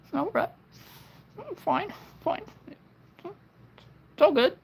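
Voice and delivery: high, breaking crying voice. Pretend. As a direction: sob it out like a hurt child. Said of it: "It's my crying voice".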